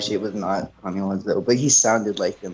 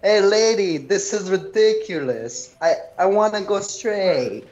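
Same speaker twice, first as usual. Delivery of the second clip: a lightly voiced frustrated driver, higher; Tommy Wiseau impression; 8 semitones higher than usual. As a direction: huff it out like the annoyed driver, slowing on ridiculous. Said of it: "Tommy Wiseau sounding person".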